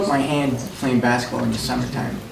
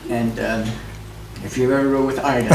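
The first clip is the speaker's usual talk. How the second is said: ordinary talk, nothing marked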